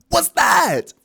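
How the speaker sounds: high, loud mock shriek; freaking out. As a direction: yell it in comic alarm. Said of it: "Freaking out, right?".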